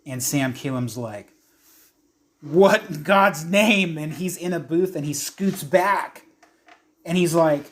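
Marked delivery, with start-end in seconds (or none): 2.4-4.0 loud, higher, drawn-out shock; horrified exclamation. Acted. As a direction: exclaim slowly in aghast disbelief. Sam reacts in horror to ooze.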